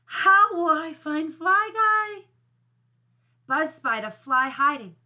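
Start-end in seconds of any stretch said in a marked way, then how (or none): 0.0-2.2 high, slow lament; crying out. A narrator's reading. Buzz despairs of finding his fly, crying out. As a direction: wail it, drawing out the words. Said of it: "cried Buzz".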